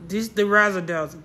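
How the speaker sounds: usual speaking voice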